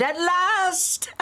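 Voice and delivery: high mock triumphant cry; retold shout. Wife mimicking his triumphant shout, mock yell. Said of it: "jumped up and shouted".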